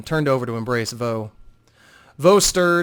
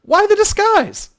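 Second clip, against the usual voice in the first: amused surprise; 9 dB louder, slower than usual. Acted. Talon amused by the disguise.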